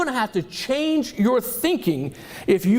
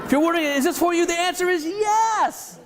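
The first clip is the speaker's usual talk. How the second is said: emphatic yes; 8 semitones higher than usual. Real, not acted. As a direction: land the yes big. Emphatic answer to audience.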